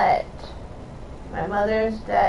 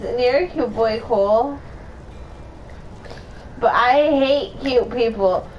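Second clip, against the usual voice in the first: usual speaking voice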